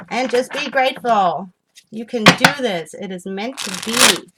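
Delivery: loud, excited encouragement; getting excited. Real, not acted. Tarot reader urging viewers on, getting excited. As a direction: cheer them on with real excitement. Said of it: "Sorry, I'm getting excited".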